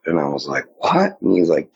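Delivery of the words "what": a sudden high yelp of disbelief; shocked disbelief. Retold or imitated. Hearing his friend say werewolf, a stunned high "What?". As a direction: jump way up in pitch, stunned.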